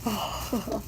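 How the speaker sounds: ordinary talk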